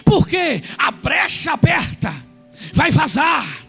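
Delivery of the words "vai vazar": normal talking voice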